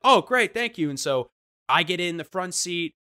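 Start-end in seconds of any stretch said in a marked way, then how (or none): 0.0-0.9 high, loud, delighted thanks; overjoyed she said yes; gushing thanks. Retold or imitated. To the driver, thrilled and grateful.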